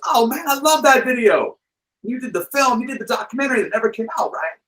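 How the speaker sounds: high, fast, gushing fan voice. Retold or imitated. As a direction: gush like a starstruck rocker. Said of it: "And [name] goes".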